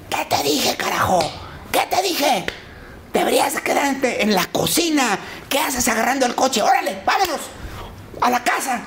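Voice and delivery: regaño agudo y exagerado al hablarle a la esposa; actúa al marido furioso; regaño fingido. Retold or imitated. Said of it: "nunca le había hablado así". (papá finge regañar a la mamá frente a los policías, voz aguda)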